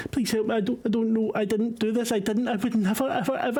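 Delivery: high, frantic confession in a stammer; breathless panicked young man; panicked informant. Acted. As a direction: blurt it out terrified and confused. GM voices pleading NPC Paul.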